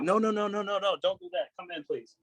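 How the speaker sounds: high, urgent string of pleas; retold pleading. Friend begging him not to fight, anxious pleading.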